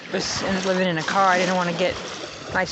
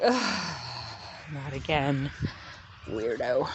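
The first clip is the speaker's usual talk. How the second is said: lower, fed-up groan; the Pentacles rolling their eyes, low and fed up; annoyed cards; 5 semitones lower, 6 dB quieter, slower than usual. Retold or imitated. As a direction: sneer it low and bored. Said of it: "And they're like".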